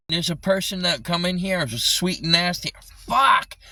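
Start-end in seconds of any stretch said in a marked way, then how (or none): 3.1-3.6 sudden high, loud curse; exasperated outburst. Real, not acted. Streamer ranting about a visitor, exasperated outburst. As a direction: burst out loudly in real frustration. Frustrated outburst during a rant.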